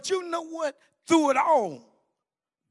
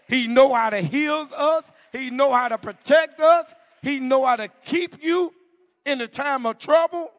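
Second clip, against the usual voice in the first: louder, higher preacher cadence; rising preaching; 5 semitones higher, 7 dB louder than usual. Acted. Preacher telling congregation how the Lord heals, rising. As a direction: lift the church with each line.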